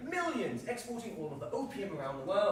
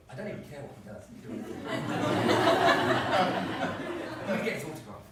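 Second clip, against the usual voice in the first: ordinary talk, nothing marked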